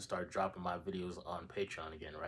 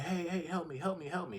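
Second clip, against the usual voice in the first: pestering crowd; 7 semitones higher than usual. Retold or imitated. Crowd pestering the man for help.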